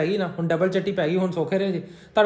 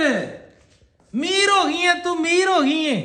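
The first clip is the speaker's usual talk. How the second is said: excited announcement; 6 semitones higher, much slower than usual. Real, not acted.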